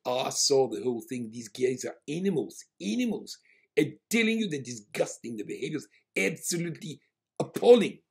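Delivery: outraged South African passenger ranting; South African accent. Retold or imitated. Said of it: "a South African guy".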